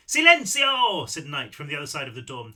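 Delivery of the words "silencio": very loud, high, drawn-out call in a put-on accent; big high accented shout. A narrator's reading. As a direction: holler it slowly through a door, accent and all. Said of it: "I did that in Seth's accent".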